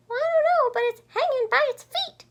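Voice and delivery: very high, loud chirping voice; chirpy baby bird. A narrator's reading. Baby bird Flitter puzzling over the bat, high chirp. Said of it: "chirped Flitter".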